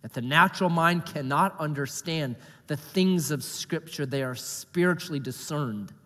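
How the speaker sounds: soft, low closing prayer; hushed pastor's prayer. Acted. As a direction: pray it quietly and reverently. Opening prayer before sermon.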